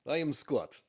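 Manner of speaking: a hint of a Russian saying his name; Russian accent. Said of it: "say it with a Russian accent".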